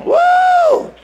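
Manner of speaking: high, loud "Woo"; loud excited whoop; joyful whoop. Real, not acted. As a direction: whoop with joy.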